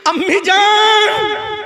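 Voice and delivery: high, slow wailing cry. Acted. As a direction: wail it like a weeping son. Son crying out to mother. (रोती हुई ऊँची पुकार)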